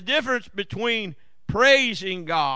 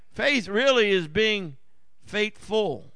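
ordinary delivery

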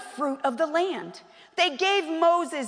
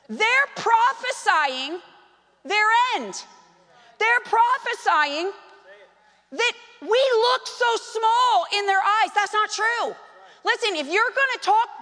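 preaching higher, building over repeated "They're prophesying"; 9 semitones higher, 3 dB louder than usual. Real, not acted. Pastor rebuking the spies' doubt, preaching higher. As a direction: hammer the repeated phrase from the pulpit.

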